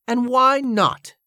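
high, drawn-out challenge; demanding. A narrator's reading. Cornelia challenges Joshua's refusal to do it, demanding. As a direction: demand an answer, pitched high and slow. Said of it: "demanded Cornelia".

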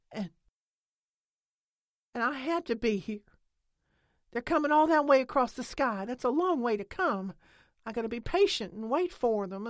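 loud and high, rising to a cry; impassioned angry tirade. A narrator's reading. Lena defends waiting for the aliens, loud and fierce. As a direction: pour it all out, fierce.

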